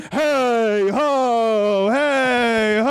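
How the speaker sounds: long, sustained chant-like impression. Host answering the prompt, long drawn-out mock chant. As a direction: hold each word out like a chanted hook. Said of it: "Naughty by Nature?".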